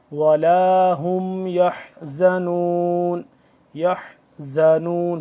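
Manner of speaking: tajweed chant, long final vowel in يَحْزَنُونَ; firm za, throat ha. Shown as a demonstration. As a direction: press the z of يَحْزَنُونَ after a throaty h. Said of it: "زا کو سختی سے، حا کو حلق کے".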